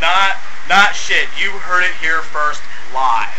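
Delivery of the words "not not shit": slow deadpan repeat. Pretend. Reporter repeats answer slowly.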